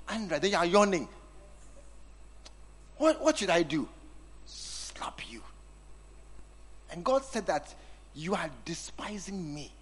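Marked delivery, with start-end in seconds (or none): 4.5-5.4 soft whispered joke; whispered aside. Real, not acted. Preacher jokes quietly to himself, whispered aside. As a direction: whisper it under your breath. Aside after "what should I do.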